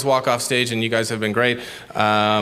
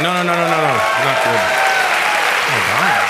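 pained, flustered protest; after bitter medicine; 2 semitones higher, 4 dB louder than usual. Real, not acted. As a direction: wince through the words. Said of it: "I'm not even joking. This is awful".

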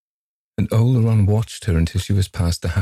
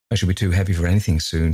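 plain reading voice

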